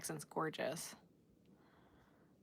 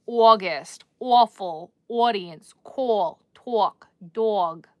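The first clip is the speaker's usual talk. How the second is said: loud, drawn-out Long Island vowels; mock Long Island accent demo; 7 dB louder, much slower than usual. Shown as a demonstration. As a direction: stretch every aw the Long Island way. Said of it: "the Long Island is not cute".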